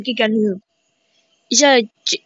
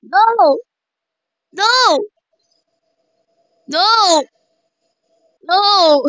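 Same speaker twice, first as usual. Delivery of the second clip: panicked no; 9 semitones higher, much slower than usual. Real, not acted. Player panicking in game.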